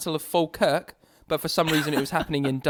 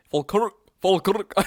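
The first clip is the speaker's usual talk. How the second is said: trying Falkirk in a Scottish accent, Falkirk with a rolled Scottish r; 3 semitones higher, 3 dB louder than usual. Shown as a demonstration. Podcast host testing the name Falkirk, light Scottish accent. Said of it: "hard to say in a Scottish accent".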